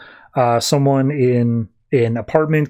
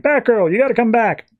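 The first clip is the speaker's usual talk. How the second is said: calling out high and urgent; 9 semitones higher than usual. Retold or imitated. Tim Drake calls Batgirl back from the fight, urgent high call. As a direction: yell across the fight to pull her back. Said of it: "He's like".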